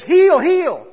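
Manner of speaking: high, loud command to a dog; calling out heel, heel high and loud. Retold or imitated. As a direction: shout it at the dog like a trainer. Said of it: "He says".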